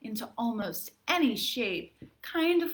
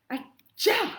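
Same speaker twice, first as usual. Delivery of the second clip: high, loud hammed-up achoo; cartoon sneeze; big fake sneeze; 9 semitones higher, 5 dB louder than usual. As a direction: throw a huge theatrical sneeze. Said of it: "a pretty dramatic reading".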